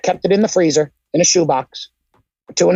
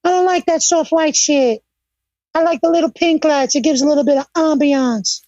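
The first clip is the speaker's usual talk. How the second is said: Jim Cornette impression; 8 semitones higher than usual. Storyteller voices the manager griping about the lighting in a high whine. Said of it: "He was like".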